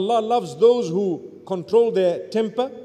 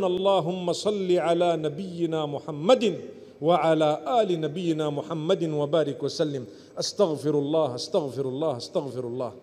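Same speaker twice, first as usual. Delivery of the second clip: soft, low murmured prayer to calm oneself; 7 semitones lower, 4 dB quieter than usual. Shown as a demonstration. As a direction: let the anger drain out in a low quiet prayer. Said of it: "What did I just do? I calmed down".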